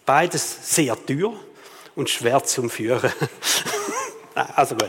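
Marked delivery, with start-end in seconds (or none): 3.2-4.1 short airy laugh at his own joke; breathy chuckle. Real, not acted. Preacher laughing at his own joke, breathy chuckle. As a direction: let out a little unvoiced chuckle.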